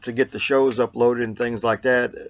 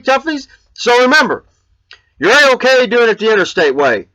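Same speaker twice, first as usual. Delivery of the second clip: higher, louder hyped host voice; show sign-off; 9 semitones higher, 6 dB louder than usual. Acted. Vlogger signing off his show to fans in a hyped host voice. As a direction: pump up the viewers like a show host.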